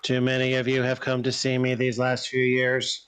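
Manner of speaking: measured, even-pitched pronouncement; weary translated character. Acted. Creature complains to the visiting investigator, measured and even. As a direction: intone it evenly, like a translated grievance.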